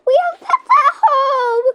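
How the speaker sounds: high, loud, slow laughing wail; panicked cry with a laugh. Pretend. Girl hearing the news about the pets, high loud laughing cry.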